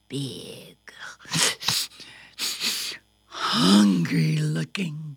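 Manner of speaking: low, slow, breathy menace; suspenseful whisper. A narrator's reading. Storyteller introducing a scary dog, slow whisper. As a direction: draw it out low and ominous.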